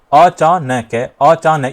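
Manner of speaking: "अचानक" as अ-चा-नैक, final syllable altered; distorted split. Shown as a demonstration. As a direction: break "अचानक" so that नक turns to नै-क. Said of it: "नक को भी तोड़ दिया जाए". ("अचानक" को बिगाड़कर अ-चा-नै-क बोलें)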